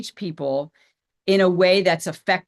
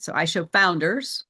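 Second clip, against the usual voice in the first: founders stressed, tune rising at the end. Shown as a demonstration. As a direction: lean on the word founders. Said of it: "emphasis on who I serve".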